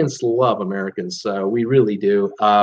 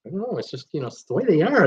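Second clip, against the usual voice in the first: soft, higher-pitched verbal shrug; imitated resigned shrug; 5 semitones higher, 4 dB quieter, faster than usual. Canadians excusing their American neighbours, softly resigned. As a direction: play it hushed and helplessly tolerant. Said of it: "we just go".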